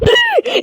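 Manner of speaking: high shouted gasp; sharp gasp. Real, not acted. Player startled by the screen, sharp high gasp. Shocked by game.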